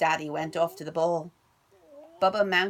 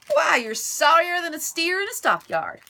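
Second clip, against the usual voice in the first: louder, higher sneering jeer; mocking taunt; 8 semitones higher, 7 dB louder than usual. Stepbrother mocking Bubba, loud sneering. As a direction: jeer at him. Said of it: "said Dwayne".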